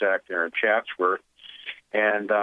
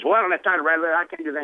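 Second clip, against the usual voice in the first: higher and louder, brushing it off; impatient refusal; 8 semitones higher, 3 dB louder, much faster than usual. Retold or imitated. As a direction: snap back, no time for this. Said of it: "felt he was kind of rude and hostile".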